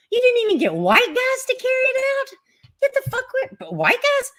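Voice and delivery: high incredulous mockery. Real, not acted. Streamer mocking the hoax story, high and incredulous. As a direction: squeal it in disbelief.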